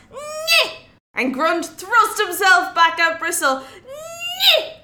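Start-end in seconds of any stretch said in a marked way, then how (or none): 0.1-0.8 a tiny worm lunging with all its might; worm battle cry. Acted. The worm Bristle thrusts at Grunt. 3.6-4.8 a tiny worm lunging with all its might; worm battle cry. Acted. The worm Grunt thrusts back.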